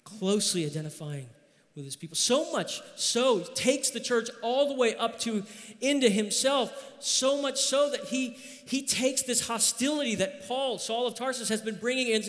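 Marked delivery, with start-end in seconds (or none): 2.2-12.2 preaching build. Acted. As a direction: climb into the point with fervor. Sermon build, pitch up.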